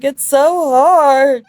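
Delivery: high, loud, drawn-out moan; milk the misery, slowly; self-pitying wail. Acted. King drowning his sorrows to the Duke, whiny wail.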